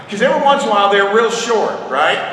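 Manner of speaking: normal talking voice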